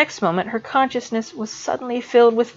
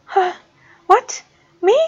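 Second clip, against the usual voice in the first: much higher startled yelps; startled Rigel; 10 semitones higher than usual. A narrator's reading. Student snapped out of panic, startled. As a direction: jolt back to the room.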